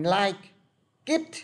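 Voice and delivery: "like", a pause, then "Kit" pitched high with a hard K; wrong slow linking. Shown as a demonstration. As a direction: break after "like" and restart on a K as "Kit". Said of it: "các bạn đọc chậm mà các bạn đọc bằng".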